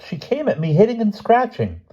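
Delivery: very high, aggrieved accusation; shocked. A narrator's reading. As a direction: report it in high-pitched shock. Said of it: "sounding shocked".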